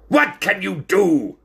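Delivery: high, slow barked demand. Acted. Ringmaster demanding an answer after silence, barking. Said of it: "barked the ringmaster".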